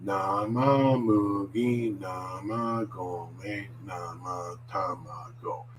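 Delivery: slow, evenly stretched syllables; real slow. Shown as a demonstration. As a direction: say the tongue twister very slowly and smoothly. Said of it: "you would start real slow".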